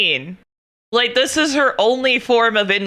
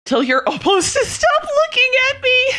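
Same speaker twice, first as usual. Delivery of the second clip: much higher, hammed-up scold; mock prudish scold; 13 semitones higher than usual. Joking to chat about showing elbows, high mock scold. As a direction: play the scandalised prude.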